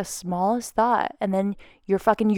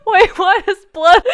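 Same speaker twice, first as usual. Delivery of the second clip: high, loud, laughing through words; 11 semitones higher, 8 dB louder than usual. Real, not acted. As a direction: giggle it out, slowly. Laughing after the joke.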